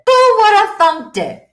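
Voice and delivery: high, loud, exclaimed quip; mock amazement. Real, not acted. Former hot mess marvelling at her success, playful exclamation. Repeats the quip in contrasting voices.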